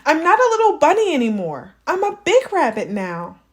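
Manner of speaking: put-on high, fast kid protest; proud little boy asserting himself; boy insisting he is grown up; insistent child.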